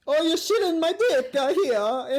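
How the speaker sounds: high-pitched, full-on mock Italian accent; Jared Leto's accent. Host quoting the actor's film role, full-on mock Italian. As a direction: go over the top with a high Italian caricature. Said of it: "fucking so full on".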